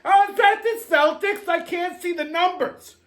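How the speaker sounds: mock a squinting old woman peering at a phone; old lady voice. Comedian mimics an old lady at the bar, high and fussy. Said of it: "There's an old couple".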